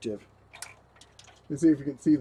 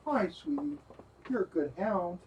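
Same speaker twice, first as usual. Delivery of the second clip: sweet talk to dog; 3 semitones higher, 11 dB quieter than usual. Real, not acted. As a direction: coo at the good hound. Talking sweetly to his dog.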